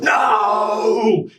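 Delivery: high, loud anguished shout; howling scream. A narrator's reading. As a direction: scream it as he is dragged away. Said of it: "and screams out".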